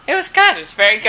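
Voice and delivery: high, loud imitation of a director's praise; imitowana entuzjastyczna pochwała. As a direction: play back the director's approval, pitched high and loud. Retells director's praise in acted voice.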